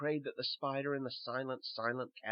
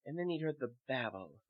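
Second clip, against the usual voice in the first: ordinary delivery, nothing marked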